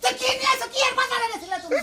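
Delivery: high, shouted reproach; angry scolding. Acted. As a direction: demand an answer in a high, angry voice. Scolding over what was brought.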